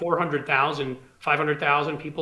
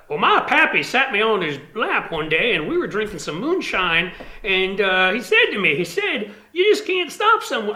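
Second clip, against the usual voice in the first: lampoon a Southern congressman's folksy tale; a hammed-up Southern accent, pitched up; mock Southern accent; 5 semitones higher, 4 dB louder than usual. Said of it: "in that accent".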